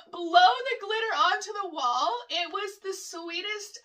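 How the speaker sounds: breaking into high laughter while talking; laughing hard. Real, not acted. Telling of dad blowing glitter on the wall, cracking up. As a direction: fight through helpless giggles to tell it.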